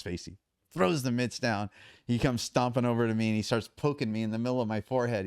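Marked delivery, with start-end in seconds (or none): none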